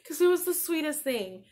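fighting back real tears; real crying. Real, not acted. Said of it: "I'm getting teary-eyed now".

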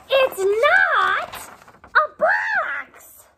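high and loud, stretched protest; bunny protesting loudly; high insistent cry. Acted.

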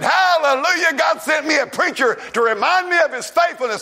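shouted high, hallelujah bursting out; overjoyed preacher's shout; joyful shout. Retold or imitated. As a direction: Noah crying out his praise. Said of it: "I believe old Noah said".

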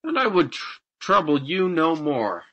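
forward, assertive reply; bold. A narrator's reading. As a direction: press the bargain confidently. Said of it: "replied the farmer boldly".

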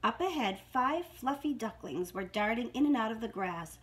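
usual speaking voice

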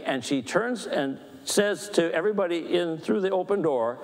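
ordinary talk

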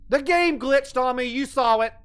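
loud defensive protest; indignant protest. Real, not acted. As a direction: insist it wasn't your fault. Protesting a failed jump.

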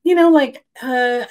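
high, rushed nervous chatter; flustered babbling after a confession. Retold or imitated. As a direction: babble nervously to fill the silence. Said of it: "all chitter chatter".

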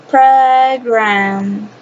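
"program" stretched very long, pro-graaam; slow teaching model of "program"; pronunciation model. Shown as a demonstration. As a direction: draw out both syllables of "program". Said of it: "So pronunciation is".

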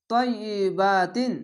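তয়িবাতিন recited very slowly, vowels stretched; long vowel drawn. Shown as a demonstration. Teacher demonstrating tajweed: slow drawn vowels. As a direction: draw out তয়িবাতিন like Quran recitation. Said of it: "এক আলিফ টেনে পড়তে হবে".

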